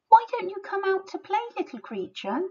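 fast, high wheedling wolf. A narrator's reading. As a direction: hurry the invite, sing-song. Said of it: "asks the wolf".

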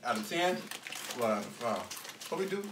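talking with food in mouth; chewing a chip. Real, not acted. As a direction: mouth partly full. Seen 0: Chip put in mouth just before; jaw working, bag in hand throughout.

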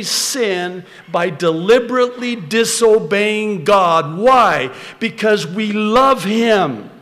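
loud, high, driving sermon delivery; full-force preaching. Acted. Preacher rousing the congregation with scripture, loud and driving. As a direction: preach it out to the whole room, rising.

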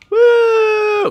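high, loud joyful woo; excited whoop. Real, not acted. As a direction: whoop as the car slides. Drifting the fire truck.

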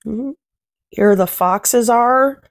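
ordinary delivery, nothing marked

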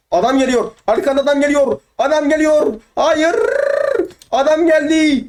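high, urgent calling out; alarmed warning. Real, not acted. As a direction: warn a teammate in rising panic. Warning that an enemy is coming.

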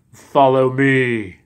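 slow, creaky servant voice. A narrator's reading. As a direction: drag it out slowly and creaky. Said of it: "Crooked I said".

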